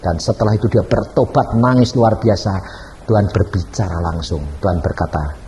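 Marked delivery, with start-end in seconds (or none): none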